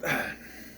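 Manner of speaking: breathy exhaled sigh; wordless sigh of cringe; exasperated sigh. Real, not acted. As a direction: let out a fed-up breath. Fed up with the cringey dialogue.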